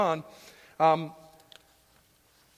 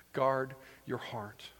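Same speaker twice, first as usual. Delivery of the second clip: slow, deliberate, softer; emphatic repetition; 7 semitones lower, 8 dB quieter, slower than usual. Shown as a demonstration. Preacher stressing the key phrase, slow and deliberate. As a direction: lay each word down slowly. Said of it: "to use repetition like this".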